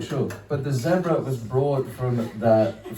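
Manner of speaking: ordinary talk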